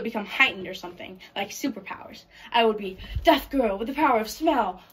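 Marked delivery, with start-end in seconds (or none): 2.5-4.8 louder, swooping comic-book hero proclamation; mock superhero announcer. Imagines self as superhero.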